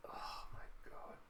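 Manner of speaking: very soft, slow whisper; stunned whisper. Real, not acted. Reader stunned by the transformation, slow amazed whisper. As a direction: breathe it out in disbelief.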